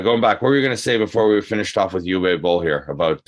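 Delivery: ordinary talk, nothing marked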